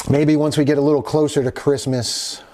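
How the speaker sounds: normal talking voice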